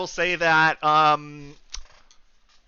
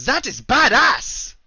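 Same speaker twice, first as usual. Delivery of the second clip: excited; 10 semitones higher, 6 dB louder, slower than usual. Real, not acted. GM excited about dragon armor.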